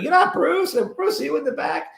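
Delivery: high, teasing stage voice; mock disappointed hollering; playful calling out. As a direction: call down the aisle. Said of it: "and said".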